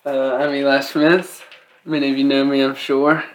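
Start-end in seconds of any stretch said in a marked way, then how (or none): none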